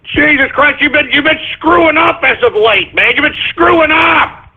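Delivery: high-pitched, indignant complaint; exasperated berating. Real, not acted. Host to engineer who keeps screwing up, exasperated. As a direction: tell them off, pitch riding high.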